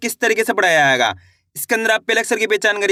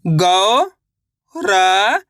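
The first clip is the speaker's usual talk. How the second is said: Gaurav stretched very slowly, rising at the end; teacher sounding out Gaurav; sounded out slowly; much slower than usual. Shown as a demonstration. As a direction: drag Gaurav out syllable by syllable. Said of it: "तो मिलके बन जाएगा".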